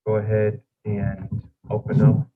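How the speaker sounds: choppy, breaking-up audio; words dropping in and out; voice cutting out. Real, not acted. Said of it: "Your voice is going in and out".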